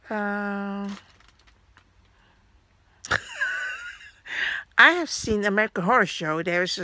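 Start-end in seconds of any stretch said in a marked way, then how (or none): 3.0-3.9 sudden high squealing laugh. Real, not acted. Laughing to viewers about the clown pages. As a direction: let a high laugh escape.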